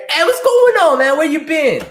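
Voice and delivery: very high, loud happy hello; delighted a regular came back; excited greeting. Real, not acted. Greets a returning viewer.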